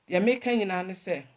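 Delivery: ordinary talk, nothing marked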